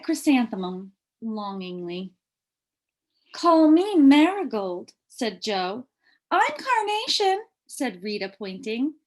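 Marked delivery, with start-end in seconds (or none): 3.3-4.9 eager. Acted. Child eager. 6.3-7.4 eager. Acted. Child eager.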